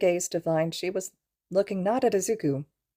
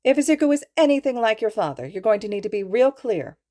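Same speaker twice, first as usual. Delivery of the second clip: firm warning; 5 semitones higher, 4 dB louder than usual. A narrator's reading. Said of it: "The softness had vanished from her voice".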